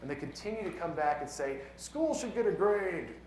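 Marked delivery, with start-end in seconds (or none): 1.8-3.2 higher, drawn-out mock demand; mocking legislators. Poet imitating legislators who continue to come back, mocking. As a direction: stretch it out like a stubborn official's refrain.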